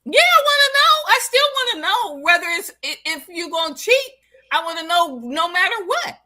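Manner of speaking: high, loud, insistent demand; loud insistence. Real, not acted. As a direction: demand it, voice shooting up. Insists on knowing about cheating.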